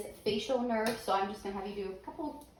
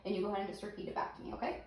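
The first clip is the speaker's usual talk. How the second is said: ordinary talk, nothing marked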